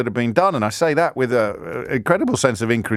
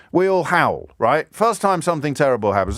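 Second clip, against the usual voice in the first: ordinary talk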